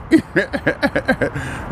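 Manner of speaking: soft, high run of chuckles; real chuckle. Real, not acted. Son recalls his tough upbringing, amused soft chuckling. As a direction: chuckle at your own quote.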